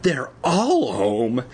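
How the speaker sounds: plain reading voice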